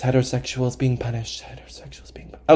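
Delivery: secret whisper to yourself; hushed, voiceless repetition; whispered mantra. Pretend. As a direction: whisper it like a calming chant. Whispered happy thought to self.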